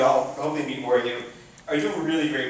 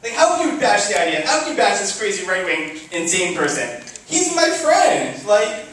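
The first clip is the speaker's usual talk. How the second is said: much higher and louder, heated protest; angry yelling; 7 semitones higher, 7 dB louder than usual. Retold or imitated. Defending Israel to leftist friends, heated indignant yelling. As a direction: bristle and yell back at your leftist friends. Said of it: "kind of yelling at a lot of people".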